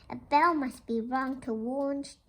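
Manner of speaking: plain reading voice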